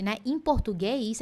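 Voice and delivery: usual speaking voice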